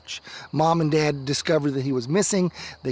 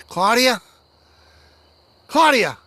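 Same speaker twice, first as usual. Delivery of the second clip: higher, louder calls into the storm; calling out; 7 semitones higher, 7 dB louder than usual. Acted. Boy calling for lost toddler in snowstorm, shouting. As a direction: shout her name into the snow.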